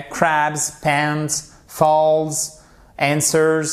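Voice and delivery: CRABS, PANS, FALLS, ANSWERS ending in z; separate, held English words; model pronunciation. Shown as a demonstration. Teacher repeats for students, demonstrative pronunciation. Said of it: "cette prononciation".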